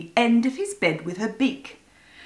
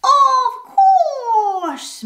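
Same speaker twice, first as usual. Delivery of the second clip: high, meowing cat voice; high mewling cat; 14 semitones higher, 5 dB louder than usual. A narrator's reading. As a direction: purr it out like a cat. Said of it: "meowed Box".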